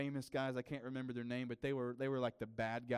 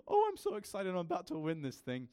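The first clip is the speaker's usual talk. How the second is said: squealed, much higher and louder on "Oh, I'm so excited"; giddy; 6 semitones higher, 5 dB louder than usual. Retold or imitated. Pastor retelling The Rock, giddy squeal before winning. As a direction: burst out like a giddy kid about to win. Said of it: "he was just so giddy".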